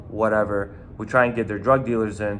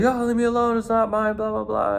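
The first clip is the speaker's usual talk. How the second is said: much higher, whiny mock pleading; whiny plea; 12 semitones higher than usual. Caught kid begging to be left alone, high and whiny. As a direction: whine your way out of it.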